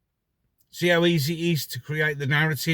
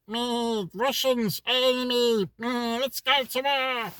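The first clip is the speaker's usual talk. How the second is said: much higher, dopey voice with slow end; higher, slowed, dim-witted mock crowd voice; mock sheeple voice; 10 semitones higher, slower than usual. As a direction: play a gullible follower parroting the news. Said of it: "the sheeple will go".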